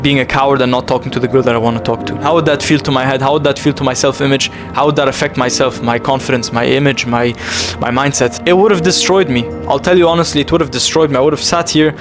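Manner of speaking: ordinary talk, nothing marked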